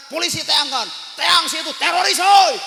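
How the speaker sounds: very loud, high-pitched shouted urging; alarmed shouting. Acted. Friends telling the councillor to fetch police on a terrorist, alarmed shout.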